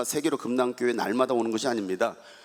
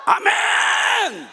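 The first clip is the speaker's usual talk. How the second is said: much higher, louder rallying cry; loud call; 4 dB louder, slower than usual. Real, not acted. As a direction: whip the crowd into a loud amen. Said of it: "가장 크게". (힘찬 아멘 외침)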